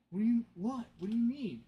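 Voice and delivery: retelling the brother's shocked reply; shocked retort. Retold or imitated. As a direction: fire back in disbelief. Brother reacts to teasing.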